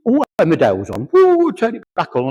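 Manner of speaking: hammed-up granny grabbing the remote; mock fussy old lady.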